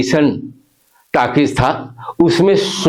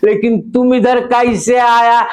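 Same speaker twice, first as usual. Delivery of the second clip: put-on Bengali accent; 4 semitones higher than usual. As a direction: play the Bengali gentleman, Bengali accent. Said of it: "दोनों का अंदाज आपके बीच पेश कर रहा हूं".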